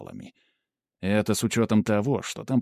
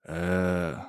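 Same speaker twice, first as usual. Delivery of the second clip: long, low-key stunned "er"; stunned, drawn out; 3 semitones lower, 4 dB quieter than usual. A narrator's reading. Hero hearing of time travel, drawn-out stunned hesitation. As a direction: stretch the sound out, mildly dumbfounded. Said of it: "ошарашенно протянул я".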